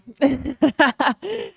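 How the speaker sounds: high-pitched real giggling laugh. Real, not acted. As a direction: laugh freely, pitched well up. Laughs after thanking viewer.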